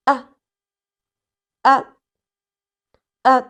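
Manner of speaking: short light "A" with no pitch movement; three quick unstressed "A"s; neutral tone, repeated. Shown as a demonstration. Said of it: "We also call it neutral tone".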